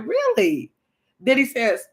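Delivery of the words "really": high-pitched, incredulous question; retold surprise. Vlogger retelling her reaction to the dialysis news, surprised. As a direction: shoot the pitch way up in disbelief.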